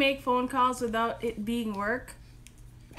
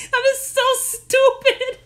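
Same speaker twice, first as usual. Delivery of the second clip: high, loud and slow through laughter; can barely get it out for laughing; giggling helplessly; 13 semitones higher, 6 dB louder, slower than usual. Real, not acted. Laughing at her own prank attempt.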